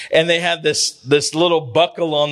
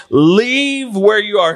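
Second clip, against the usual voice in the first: drawn-out, slightly raised plea; emphatic call; 3 semitones higher, 4 dB louder, slower than usual. Acted. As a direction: call out slowly, leaning on every word. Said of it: "I'm telling you emphatically".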